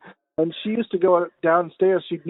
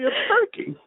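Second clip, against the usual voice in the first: mock answer. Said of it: "And I was like".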